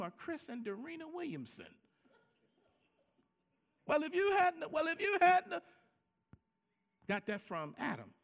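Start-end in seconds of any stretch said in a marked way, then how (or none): 3.9-5.6 blaming retort. Acted. Acting out a couple blaming each other.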